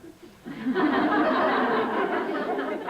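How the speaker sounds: loud high laugh at her own joke; high burst of laughter. Real, not acted.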